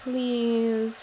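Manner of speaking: ordinary talk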